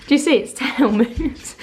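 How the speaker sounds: usual speaking voice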